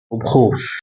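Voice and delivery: plain reading voice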